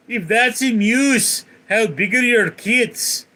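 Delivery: high, loud, slow mock-Scottish punchline; hammed-up Scottish accent, loud and drawn out; Scotsman, Scottish accent. Scotsman replying to the Canadian, loud slow mock Scottish accent. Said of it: "the Scotsman goes".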